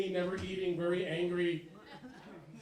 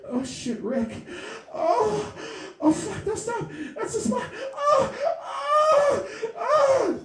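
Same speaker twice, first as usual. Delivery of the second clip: hammed-up fake moaning, higher and louder; fake orgasm; 12 semitones higher, 10 dB louder, much slower than usual. Shown as a demonstration. Comic demonstrating a woman's fake orgasm, loud mock moans. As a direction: build a loud, over-the-top fake climax. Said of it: "the fake is".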